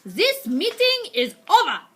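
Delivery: loud, high commanding shout; shouting witch. Acted. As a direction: bellow it to end the meeting. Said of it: "she shouted".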